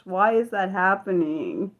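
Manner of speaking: usual speaking voice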